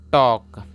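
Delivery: "टॉक" with the L dropped; silent L. Shown as a demonstration. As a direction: say "टॉक" as aw then k, no L. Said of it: "L को silent मानते हैं".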